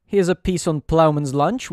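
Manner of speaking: usual speaking voice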